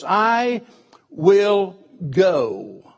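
"I will go" with a lift on "I" and a firm "go"; determined. Retold or imitated. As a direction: say it with a spirit of determination. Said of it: "with this spirit of determination".

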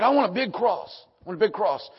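loud, high, insistent retelling; eager demand. Retold or imitated. Grateful man asking neighbour for a big cross, eager demand. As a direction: punch out the request emphatically.

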